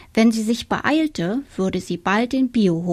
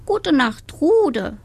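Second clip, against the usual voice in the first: higher, sleepy hen goodnight; 7 semitones higher, 3 dB louder, slower than usual. A narrator's reading. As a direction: answer like a drowsy hen. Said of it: "antwortete Agathe".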